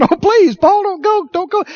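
high, urgent begging; pleading. Acted. People clinging to Paul as he leaves, pleading.

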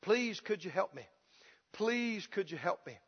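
soft, low, monotonous plea; mock beggar's quiet refrain; repeated begging. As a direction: beg meekly, over and over. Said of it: "To ask over and over again".

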